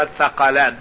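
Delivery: "الثقلان" with a short "a" after the th; fatha on the tha. Shown as a demonstration. Teacher showing students the vowelling of "الثقلان", tha with fatha. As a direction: say "الثقلان" as tha-qa-lan, not thi. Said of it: "بفتح الثاء".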